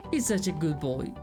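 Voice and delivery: ordinary talk, nothing marked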